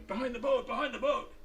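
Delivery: high-pitched, strained call across a deck; shouting a warning. Acted. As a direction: call it out high and urgent, as the lookout. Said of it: "he's shouting".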